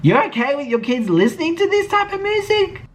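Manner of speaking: high incredulous-sounding query; questioning her. Retold or imitated. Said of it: "that was a question to her".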